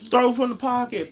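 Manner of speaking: high mocking parrot of the coaching phrase; mocking the coaching line. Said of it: "the emphasis on".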